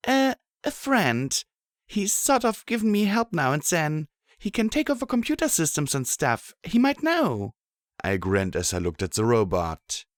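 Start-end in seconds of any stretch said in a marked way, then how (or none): 0.1-7.5 put-on higher young mare voice; Blackjack voice. A narrator's reading. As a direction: voice the heroine, higher. Blackjack explains.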